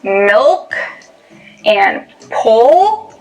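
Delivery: pole drawn out very slow with a dark L; milk and pole with the tongue back, dark L. Shown as a demonstration. Teacher showing a dark L in milk and pole. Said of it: "when you produce the dark L".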